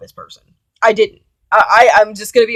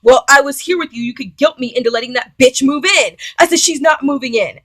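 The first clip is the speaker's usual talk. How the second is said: loud, heated confrontation; loud angry rebuke; angry outburst; 4 semitones higher, 10 dB louder than usual. Retold or imitated. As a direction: tell him off hard.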